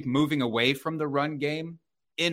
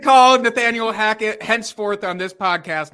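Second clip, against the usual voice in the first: mock proclamation; 8 semitones higher, 9 dB louder than usual. Mock formal announcement.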